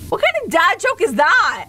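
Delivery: high, incredulous outburst; appalled disbelief. Real, not acted. Streamer reacting to a morbid dad joke, appalled. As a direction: pitch it up in scandalized protest.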